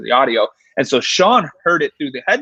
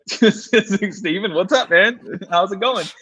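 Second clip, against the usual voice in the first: cheery greeting; 6 semitones higher, slower than usual. Retold or imitated.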